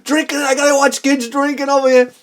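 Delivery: mildly voiced angry old man; grumbling old man. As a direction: complain like a fed-up neighbor. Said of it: "The old man was so pissed".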